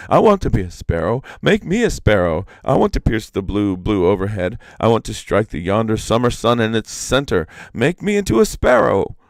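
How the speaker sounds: loud, lightly wailed, a bit fast; wailing demand. A narrator's reading. Iktomi begging the sparrow to change him, mild wail. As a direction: whine and push for it. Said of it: "rushed upon him and wailed".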